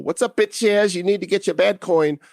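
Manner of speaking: jokey high-pitched holler at normal volume; playful mock call-out. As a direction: hail them in a silly, high voice. Mock call-out to BitShares holders.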